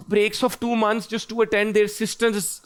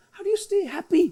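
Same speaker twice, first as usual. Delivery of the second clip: much higher, lifting upbeat question; bright question; 13 semitones higher than usual. Real, not acted. Host asking guest her secret to happiness, cheerful. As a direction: ask it with a lift of joy.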